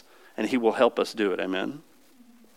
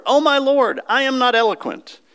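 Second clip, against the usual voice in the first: pleading Moses; 10 semitones higher than usual. Acted. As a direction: beg the Lord, voice lifted high.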